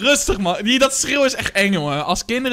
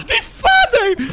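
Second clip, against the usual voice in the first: high, loud, drawn-out laughing words; real cracking up mid-phrase; helpless laughter; 13 semitones higher, 5 dB louder, slower than usual. Real, not acted. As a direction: squeal it slowly through laughter. Cracks up at father's reaction.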